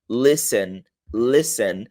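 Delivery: listen slowly as "lessen", no T; slow listen demo. Shown as a demonstration. Teacher slowly modeling listen for students. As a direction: drag out listen with a silent T. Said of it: "você pronuncia assim".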